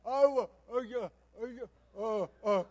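acted-out panicked stammering; loud, halting mock fluster. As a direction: fumble for words after being caught. Caught out, fumbling for words.

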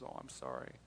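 telling a grieving woman he is sorry, soft and low; soft sympathy. Retold or imitated. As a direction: hush it, a quiet condolence. Consoling her over grandma's death.

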